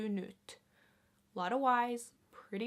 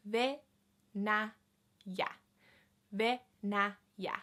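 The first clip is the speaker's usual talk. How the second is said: slow demo; slower than usual. Shown as a demonstration. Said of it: "repeating word for learners".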